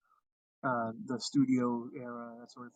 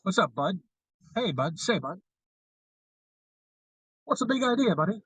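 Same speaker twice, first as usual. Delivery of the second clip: Mid-Atlantic accent; 5 semitones higher, 5 dB louder than usual. Shown as a demonstration. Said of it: "That's why I call everybody Bub".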